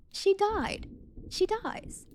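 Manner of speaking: very high, soft shock; stunned by the twist; shocked squeak. Real, not acted. Shocked reaction to book.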